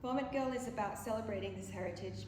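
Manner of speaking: ordinary delivery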